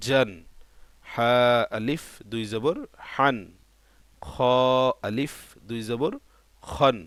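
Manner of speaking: letter-by-letter drill with drawn-out letter names; spelling recitation. Shown as a demonstration. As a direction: stretch each letter, pause, then land firmly on the syllable. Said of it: "শুধু বলার সময় আমরা বলবো". (টেনে টেনে বানান করে পড়া)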